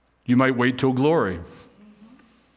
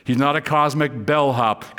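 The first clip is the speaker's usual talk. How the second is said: ordinary talk, nothing marked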